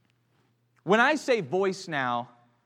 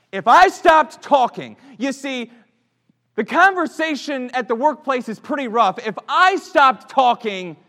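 preaching louder and higher, building to joy; rising sermon passion; 6 semitones higher, 11 dB louder than usual. Acted. Preacher to the church, building excitement. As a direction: swell the congregation up with wonder.

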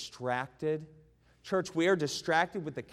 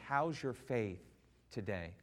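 normal talking voice

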